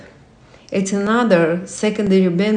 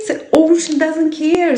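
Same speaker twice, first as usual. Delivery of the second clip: much higher, mock-grand voice; a higher playful voice for the ocean; put-on voice; 7 semitones higher than usual. Shown as a demonstration. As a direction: say it again in a lofty funny voice. Repeats line in put-on voice.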